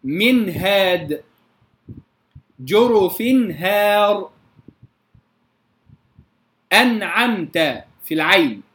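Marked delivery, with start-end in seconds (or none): 0.0-4.3 من هاد and جرف هار slowly, clear noon before the ha; izhar before ha. Shown as a demonstration. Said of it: "الهاء". 6.7-7.8 أنعمت slowly, crisp noon straight into the ayn; no bounce or vowel after the noon of أنعمت; still noon. Shown as a demonstration. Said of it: "أسكن النون ولا أقلقلها".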